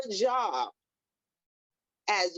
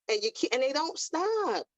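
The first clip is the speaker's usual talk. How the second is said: ordinary talk, nothing marked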